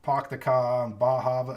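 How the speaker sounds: Maine accent with dropped r, Poc the Ca; mild Maine accent demo. Shown as a demonstration. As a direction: say Bah Hava the Maine way, no r sounds. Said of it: "you do hear that".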